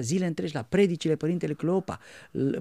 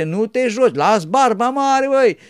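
loud high, slow stretched advice; old monk insisting; 8 semitones higher, 11 dB louder than usual. Retold or imitated. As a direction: insist loudly, grow it long.

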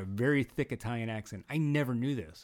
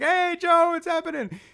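high, loud imitation of a shopkeeper's hello; called-out greeting; 8 dB louder than usual. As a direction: call back to a regular customer, pitched way up. Acting out shopkeeper's greeting back.

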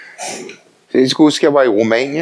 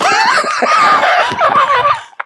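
hearty laugh. Real, not acted. Preacher laughing about teasing his old friends.

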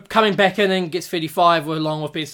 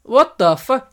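higher, louder astonished outburst; mock disbelief; 7 semitones higher, 5 dB louder, slower than usual. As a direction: react in stunned disbelief. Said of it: "you're like".

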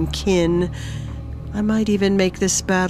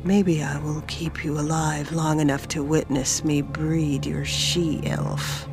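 softer, understated vicious taunt; 3 semitones lower, 8 dB quieter than usual. A narrator's reading. Sermanos goading Ejlum, softly vicious taunt. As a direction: taunt him quietly, with a touch of cruelty. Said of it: "Sermanos taunted viciously".

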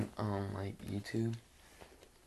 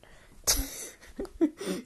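giggle; 6 dB louder than usual. Real, not acted. Laugh after censoring.

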